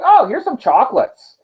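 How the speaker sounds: high, sweet-talking suitor; a playful, flirty offer of gifts; smitten god.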